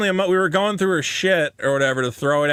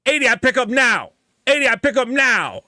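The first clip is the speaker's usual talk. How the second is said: put-on high, clipped broken English haggling, Chinese accent; mock Chinese accent; 5 semitones higher than usual. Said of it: "I'm like".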